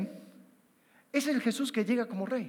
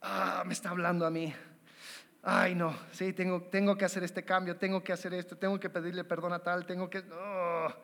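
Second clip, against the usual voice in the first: uneasy realisation; 4 semitones lower, 8 dB quieter than usual. Retold or imitated. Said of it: "estoy escuchando un mensaje y digo".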